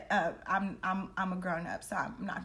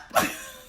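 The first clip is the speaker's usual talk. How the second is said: a high burst of giggling; high giggle; 3 dB louder than usual. Real, not acted. Laughing at her own confession to the audience, high giggle. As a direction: giggle at yourself.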